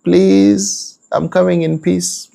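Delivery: high, coaxing peace-making voice; placating husband. Retold or imitated. Husband coaxing his angry wife, high and placating. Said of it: "He has come in peace".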